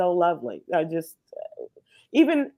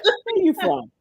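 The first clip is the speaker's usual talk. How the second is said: high, pointed question to an outsider; suspicious challenge; 12 semitones higher than usual. Retold or imitated. Sandwich guy turns suspicious on a visitor, higher pointed question. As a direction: voice the guy narrowing his eyes at you.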